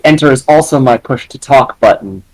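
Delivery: ordinary talk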